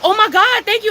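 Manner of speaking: high, gushing thrill; retold delight. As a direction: imitate someone overjoyed by a gift. Retold delight at receiving a cigarette.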